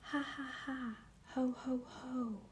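high hushed ha ha ho ho; a light teasing laugh at the gnome; mocking laugh. Someone laughs at the gnome under the bush, mock laugh. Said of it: "suddenly laughed someone".

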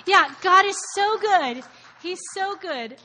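heartfelt slow exclamation; fervent praise. Real, not acted. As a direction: lift it up in praise. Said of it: "praising God emphatically".

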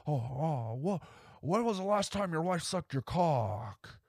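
spring the question in a high unhinged burst; Nicolas Cage impression. Cage's killer blurting the question at the guest, high and manic. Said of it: "something purely Nick about that moment".

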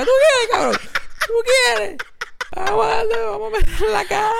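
high-pitched, worked-up challenge; mock fighting yell. Kid waves bamboo at the bully, mock fighting yell. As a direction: yell it like a kid itching to brawl.

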